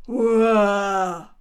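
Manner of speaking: a huge ringing shout of hurra; a crowd bursting into hurra; loud cheer from outside. Acted. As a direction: cheer it out at full voice. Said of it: "machte es draußen".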